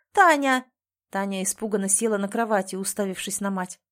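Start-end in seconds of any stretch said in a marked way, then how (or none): 0.1-0.7 loud, high call of a name; urgent call. Acted. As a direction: snap the name out to wake someone. Mother wakes daughter urgently.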